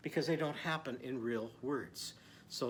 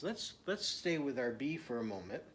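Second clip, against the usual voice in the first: ordinary talk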